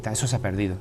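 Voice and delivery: ordinary talk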